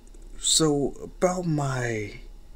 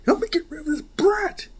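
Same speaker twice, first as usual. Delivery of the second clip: angry yelling; 4 dB louder than usual. Acted. Thug yelling at his goons.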